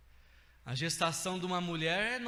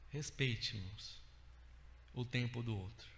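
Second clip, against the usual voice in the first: quiet appeal; 9 semitones lower, 9 dB quieter than usual. Real, not acted.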